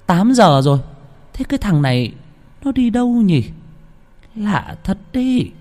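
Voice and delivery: grandma worrying, slowing down at the end; worried grandma. Acted. Grandma wonders why the youngest is late, worried grandmother voice.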